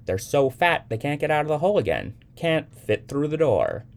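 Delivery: high, loud mock amazement; playful storyteller. A narrator's reading. Young man telling Sybil about trapped bananafish, mock-amazed. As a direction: spin the tall tale with big emphasis. Young man's tall tale to child.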